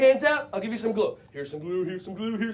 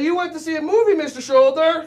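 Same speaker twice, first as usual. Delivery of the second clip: lightly higher and louder; cheerful mock chat with a shoulder; playful call; 5 semitones higher, 6 dB louder than usual. Shown as a demonstration. Teacher modeling what kids should say to their shoulder.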